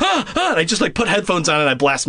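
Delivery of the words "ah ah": panicked scream. Retold or imitated. Hearing whistles from the ceiling, high frightened screams. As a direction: shriek like something's in the ceiling. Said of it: "I was like".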